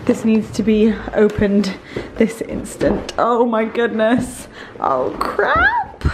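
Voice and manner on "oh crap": very high startled cry and gasp; shocked squeal. Real, not acted. Overwhelmed opening big delivery.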